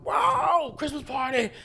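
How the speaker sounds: high, loud party cheer; excited shout. Retold or imitated. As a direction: whoop it like a rowdy crowd. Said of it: "they're just all".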